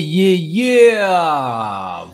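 loud drawn-out "yeah", rising high then falling; hype cheer. Real, not acted. Host cheering a producer's track, loud drawn-out hype.

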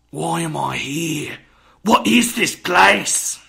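put-on slow, high theatrical yell in a British accent; yelling British ghost. Ghost in the play demanding where it is, slow British yelling. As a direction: wail it out loud and drawn-out, as a British stage ghost. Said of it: "I was yelling a lot. It was a ghost".

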